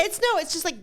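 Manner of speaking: throat catching from dust; clearing a dusty throat; dust coughing. Real, not acted. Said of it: "dust in my throat".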